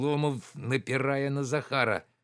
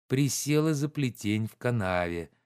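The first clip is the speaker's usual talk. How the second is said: ordinary delivery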